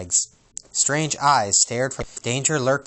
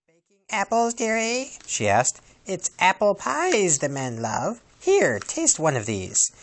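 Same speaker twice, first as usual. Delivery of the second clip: high, slow crone voice; old peddler witch; 8 semitones higher, slower than usual. Acted. Disguised queen sweetly tempts the princess with apples.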